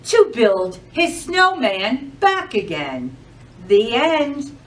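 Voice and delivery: lively, sing-song storytime voice; animated picture-book narration; reading to children. Acted. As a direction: read it like a librarian to a room of kids.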